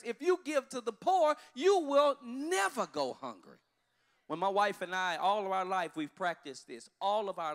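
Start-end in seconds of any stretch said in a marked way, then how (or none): 0.0-3.6 loud, slow pulpit proclamation; preaching. Acted. Pastor to congregation, preaching at full force. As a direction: proclaim it as a promise.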